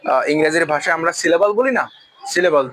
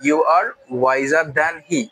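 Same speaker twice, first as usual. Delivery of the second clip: very slow, stress on are; slow formal variant; much slower than usual. Shown as a demonstration. Teacher giving the formal he version, slowly. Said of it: "তুমি তার চেয়ে জ্ঞানী".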